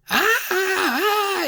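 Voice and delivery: high, far-off mock screams of a woman; distant screams. Lollipop lady screams in the distance, high mock wail. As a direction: wail like someone shouting from way behind. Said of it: "I just hear".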